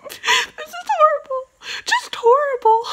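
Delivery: high, whiny mock sob; mock crying. Vlogger fake-crying over reaching for the dog's treats. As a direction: wail it in hammed-up despair.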